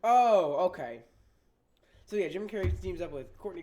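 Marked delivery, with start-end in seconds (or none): 0.0-0.6 loud, high "oh" of discovery; sudden realization. Real, not acted. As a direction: burst out as the answer clicks. Finds the answer after looking up.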